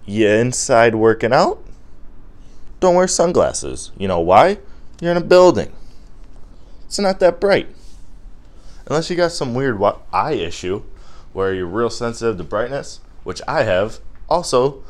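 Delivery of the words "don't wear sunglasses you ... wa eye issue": mock incredulous lecture; exasperated lecture. Real, not acted. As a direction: talk down to the sunglasses guy. Lecturing gym guys.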